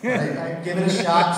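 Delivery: ordinary delivery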